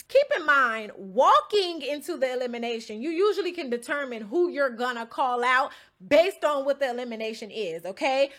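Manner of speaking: ordinary talk, nothing marked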